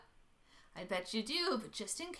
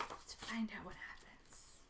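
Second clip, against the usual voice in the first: whisper; 4 semitones lower, 12 dB quieter than usual. Acted. Building suspense before story.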